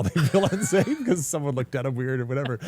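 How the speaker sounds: chuckling through the line; laughing. Real, not acted. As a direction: laugh while making the point. Laughs at his own point.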